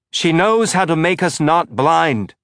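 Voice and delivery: interrupting with a loud slow shout. A narrator's reading. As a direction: shout it over her, slowly and loud. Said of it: "Fayez shouted".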